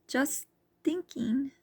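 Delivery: high, slow, soft excuse; making an excuse. A narrator's reading. Charlene brushing off Tor's worry, soft evasive excuse. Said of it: "uttered as an excuse".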